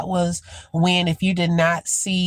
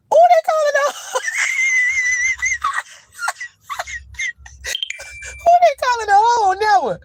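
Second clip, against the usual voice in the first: laughing outrage; much faster than usual. Real, not acted. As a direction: squeal it through laughter, pretend offended. Mock offence at a word.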